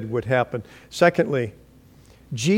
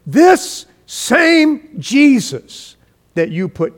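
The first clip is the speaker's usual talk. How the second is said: bold preaching; 9 semitones higher, 7 dB louder, much slower than usual. Retold or imitated. Peter preaching boldly at Pentecost.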